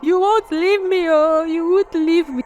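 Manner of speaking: high, drawn-out imitation wail; hammed-up hysterical girlfriend; mock wailing. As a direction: wail it in a put-on high voice, without real volume. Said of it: "Starts screaming at the top of her lungs, wailing".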